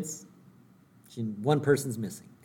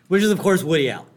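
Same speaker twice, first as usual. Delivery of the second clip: ordinary talk, nothing marked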